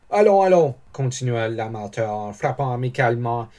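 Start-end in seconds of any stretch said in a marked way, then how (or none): 0.1-0.7 higher, louder hearty cheer; hearty encouragement. Acted. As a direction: chivvy a young man along heartily. L'armateur encourage le jeune homme. (encouragement lancé d'une voix forte)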